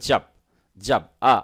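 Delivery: 'জব' opened to 'jahb', then a bare 'ah'; American vowel. Shown as a demonstration. Teacher drilling American 'জব', open ah vowel. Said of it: "আ sound আসবে".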